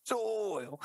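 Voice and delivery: SOIL sung out high, sliding down; drawn-out SOIL. Acted. Goading co-host who objects, drawn-out high soil. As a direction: stretch "Soil" long and high as a taunt. Repeats the teasing pun.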